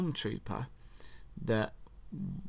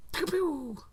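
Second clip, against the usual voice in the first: high pew pew sound; blaster noises. Shown as a demonstration. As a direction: mimic the shots.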